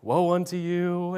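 slow, solemn warning; a measured prophetic woe; a hint of a stern prophet; stern Jesus. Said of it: "He's just like".

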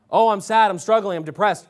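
whiny high "Oh, I'm sad" in mockery; a mock sad sigh; mocking self-pity.

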